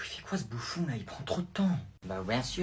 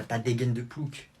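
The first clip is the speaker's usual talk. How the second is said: usual speaking voice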